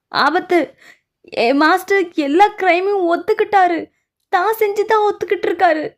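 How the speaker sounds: high, loud alarmed cry of bad news; frantic servant announcing the master confessed. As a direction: burst in shouting the danger, pitch way up.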